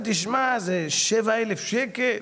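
drawn-out slow price; slow price reveal. Retold or imitated. Salesman to speaker, slowly naming the price.

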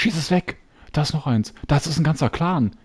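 high, eager, urgent; excited urging. Real, not acted. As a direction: urge your friend to shoot now. Urging to shoot.

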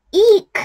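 high loud squeal of fright; squealed eek. A narrator's reading. Cheetah crashing into elephant, high squeal. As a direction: squeak as they collide.

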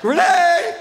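loud high-pitched yell; mock dog call. Driver hollering to head dog Renee, loud high yell. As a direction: scream the name up at the dog.

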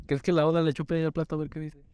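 usual speaking voice

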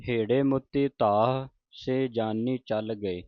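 rest on ਧਾਹ with its h sounded, then ਸੇ; a clear halt after ਧਾਹ; pause after ਧਾਹ. Shown as a demonstration. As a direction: break the verse between ਧਾਹ and ਸੇ. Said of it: "ਧਾਹ ਤੇ ਲਾਉਣਾ ਹੈ".

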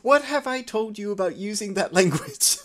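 a hint of a stern mom; scolding mother. Mother scolding her daughter for swearing. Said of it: "you hear the mom just go".

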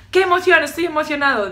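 high, loud burst of excitement; excited entrepreneur. Acted. Entrepreneur starting the morning, loud and excited. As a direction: act out a thrilled morning cheer. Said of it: "por la mañana el emprendedor dice".

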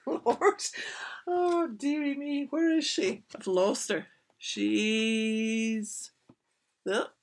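high, loud, laughing exclamation; flustered, voice jumping up loud; real flustered fluster. Real, not acted. Reader loses the dog in the picture, flustered.

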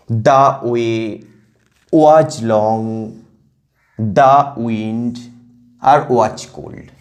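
measured, two syllables at a time with pauses between; reading in pairs. Shown as a demonstration. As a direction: scan the line foot by foot. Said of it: "দুটো দুটোকে নিয়ে জোর করবে".

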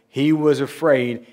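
ordinary talk, nothing marked

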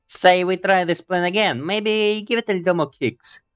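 high, lightly put-on woman's voice; femalish character voice. Gamer voicing a heroine proposing a plan, high femalish voice. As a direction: pitch it up into a gentle female character. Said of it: "I started her voice with a bit more gently and maybe femalish".

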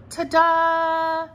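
high, loud, stretched ta-da with a laugh; ta-da reveal. Real, not acted. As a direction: present it like a magic trick. Reveals her card.

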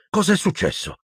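a touch of fright in the voice; frightened. A narrator's reading. Harry asks injured Ginny what happened, frightened. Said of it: "disse spaventato Harry".

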